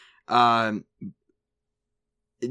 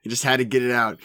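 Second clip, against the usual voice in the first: ordinary talk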